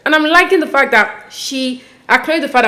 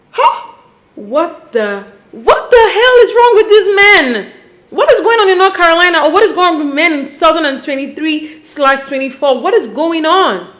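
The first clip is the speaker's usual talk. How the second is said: incredulous outrage; 5 semitones higher than usual. Real, not acted. Host asking what is wrong with these men, outraged. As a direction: wail it out in exasperation.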